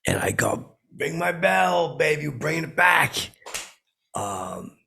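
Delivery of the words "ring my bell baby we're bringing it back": hype announcement. Retold or imitated. Announcing song on the mic.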